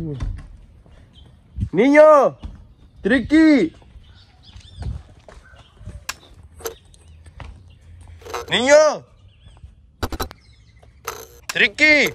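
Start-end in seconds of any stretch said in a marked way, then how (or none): 1.7-12.2 loud, high-pitched yell into the distance; shouted calls. Real, not acted. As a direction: call out names at full voice to find lost friends. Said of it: "hay que gritarles". (llamados a gritos)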